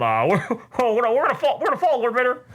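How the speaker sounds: high, frantic trooper pleading; panicking trooper. Retold or imitated. The stormtrooper pleads with Vader in high mock panic. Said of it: "Uh, Lord Vader".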